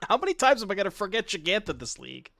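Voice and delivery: fast, exasperated and loud. Real, not acted. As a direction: beat yourself up, half laughing. Frustrated at own mistakes.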